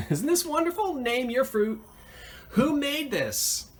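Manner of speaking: thrilled, squealing over a find; excited gush. Real, not acted.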